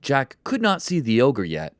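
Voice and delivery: plain reading voice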